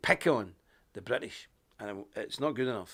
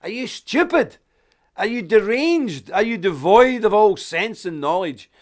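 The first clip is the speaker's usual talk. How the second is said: exasperated rant; 7 semitones higher, 11 dB louder than usual. Acted. Mocking lefties at rallies.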